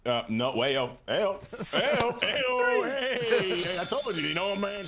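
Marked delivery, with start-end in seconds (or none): 1.1-4.9 mock protest. Real, not acted. Mock protest at suggestive remark.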